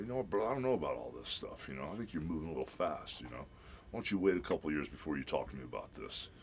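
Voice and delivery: low, quiet stonewalling; Hollywood Hogan heel. Retold or imitated. Father stalls daughter's suitor, playing the wrestling heel. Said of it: "Hollywood Hogan, that heel".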